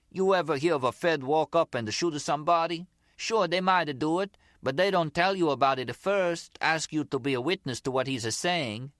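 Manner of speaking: high-pitched mock Italian accent; imitated Zip's accent. Gloria quoting Tommy for Nicky, in an imitated Italian accent. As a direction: mimic an Italian-accented gangster, secondhand. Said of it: "giving her version of the Zip's accent".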